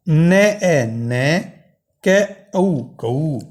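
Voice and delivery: slow, drawn-out syllable blending into धावतः; teacher joining letters into words slowly; sounding out letters. Shown as a demonstration. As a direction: spell out each letter, then join into धावतः. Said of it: "अगला है".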